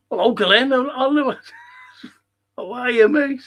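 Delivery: high, loud greeting with a laugh; big laughing hello to a caller; overjoyed greeting. Real, not acted.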